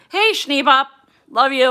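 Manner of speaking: loud, bright call across the room. Real, not acted. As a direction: call out affectionately from afar. Calling out to someone offstage.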